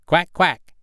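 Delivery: the mother duck calling her ducklings into the water. Acted. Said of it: "cried she".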